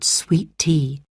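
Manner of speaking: ordinary delivery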